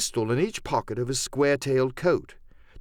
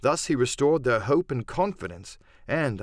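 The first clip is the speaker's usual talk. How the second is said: usual speaking voice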